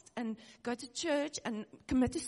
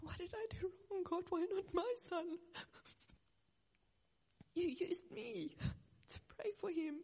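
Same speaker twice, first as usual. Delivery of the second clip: higher, softer cry to God; anguished plea; 8 semitones higher, 4 dB quieter than usual. Real, not acted. Grieving mother questioning God about her son, anguished. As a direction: ask God why, voice breaking.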